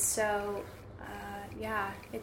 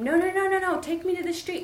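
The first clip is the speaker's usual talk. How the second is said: much higher, louder insistent no's; panicked correction of the driver; frantic protest; 9 semitones higher, 7 dB louder than usual. Retold or imitated.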